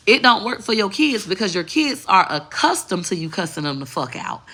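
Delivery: ordinary delivery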